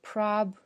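prob as one stressed syllable; isolated first syllable. Shown as a demonstration. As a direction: say prob on its own. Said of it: "第一个音节是prob".

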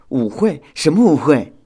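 very curious. A narrator's reading. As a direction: perk up with curiosity. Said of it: "修想很好奇". (好奇地追问)

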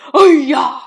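sudden acted shout of alarm; sudden loud cry. A narrator's reading. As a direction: burst out with a shouted "ow". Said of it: "忽然大叫一声".

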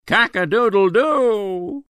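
high crowing rooster call. A narrator's reading. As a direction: crow it like a rooster. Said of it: "A rooster crows".

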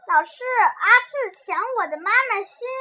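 put-on high, loud, quick child's whine; acted tearful tattling. A narrator's reading. Child reporting a snatched heart to teacher, mock-tearful and loud. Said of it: "每天都有小朋友哭着说".

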